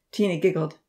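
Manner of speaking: ordinary delivery, nothing marked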